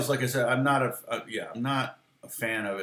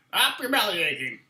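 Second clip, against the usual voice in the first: imitating Bruce; 6 semitones higher than usual. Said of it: "That's how he talks".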